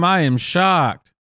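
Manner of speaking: slow, deadpan mock surprise; sarcastic shock. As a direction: drag it out, clearly not shocked.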